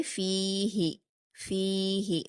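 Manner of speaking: in فیہ the ی is held long and the ہ said quick; drawn-out recitation. Shown as a demonstration. Teacher modelling فیہ for pupils, long ی then short ہ. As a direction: stretch فی, clip ہ. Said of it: "دھیان سے پڑھیں گے".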